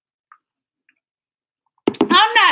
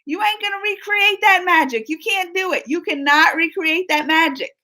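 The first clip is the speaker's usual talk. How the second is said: mock scolding; 7 semitones higher than usual. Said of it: "I was like".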